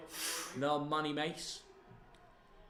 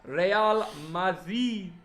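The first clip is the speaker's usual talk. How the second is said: put-on Spanish accent; 3 semitones higher, 4 dB louder, much slower than usual. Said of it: "in the Spanish accent".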